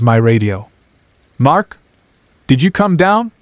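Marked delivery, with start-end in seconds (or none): 1.4-3.3 rising, uneasy question; ask, unsettled and unsure. Acted. Startled, calling on radio.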